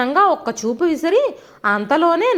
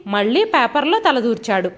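ordinary delivery

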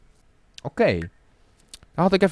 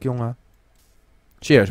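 ordinary talk

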